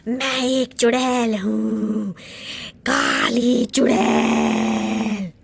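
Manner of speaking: low slow evil cackle into boast; witch cackle and voice. Acted. Witch announcing herself to the family, low slow gloat. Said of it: "काली भयानक चुड़ैल".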